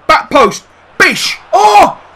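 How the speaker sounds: excited shout. Real, not acted. Manager watching a near goal, shouting excitedly. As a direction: erupt as the chance comes.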